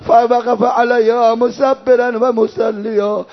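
slow, sustained chanted mourning; farewell lament. Acted. Reciter voicing father's last farewell to daughter, mourning lament. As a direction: draw out each phrase in grieving recitation.

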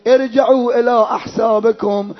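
drawn-out Arabic lamentation chant; mourning recitation. Acted. Reciter telling mourners of Hussein's challenge, mourning recitation. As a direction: recite slowly in a ringing, sustained tone.

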